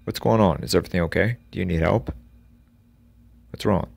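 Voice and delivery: loud, high calls out the window; shouting out. Acted. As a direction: yell out to the stranger on the road. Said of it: "he shouted".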